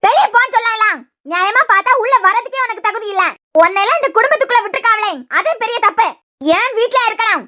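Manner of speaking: heated, contemptuous tirade; angry berating. Acted. Aunt berating an unwelcome girl at the bedside, angry. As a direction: tear into her and order her out.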